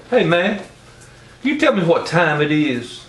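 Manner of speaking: high, casual voice asking the time; stranger's voice. Retold or imitated. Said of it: "He says".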